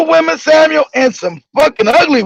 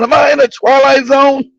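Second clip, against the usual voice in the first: usual speaking voice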